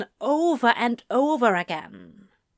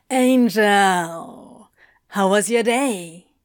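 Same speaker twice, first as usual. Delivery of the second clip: louder, drawn-out cheerful greeting; easygoing cheery drawl; 2 semitones lower, 4 dB louder, slower than usual. A narrator's reading. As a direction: sing out a hearty greeting, stretching the first word. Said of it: "a cheery voice drawled".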